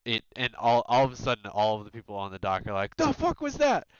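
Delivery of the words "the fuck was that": high, loud startled yell; startled shout. Acted. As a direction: yell it out in shock. Dock workers startled by splash.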